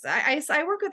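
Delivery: ordinary talk, nothing marked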